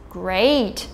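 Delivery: slow, annoyed, sarcastic 'great'. Shown as a demonstration. Teacher showing English tone with a sarcastic, annoyed 'great'. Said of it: "using sarcasm to indicate that the speaker is annoyed".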